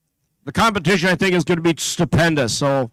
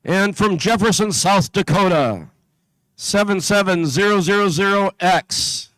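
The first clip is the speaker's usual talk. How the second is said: steady, carrying PA announcer voice; announcer read; slower than usual. Acted. Announcer calling out teams to the competition crowd, steady and carrying. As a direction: call out each town and team number to the hall.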